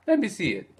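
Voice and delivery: a lightly voiced Italian guy asking. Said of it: "And he says".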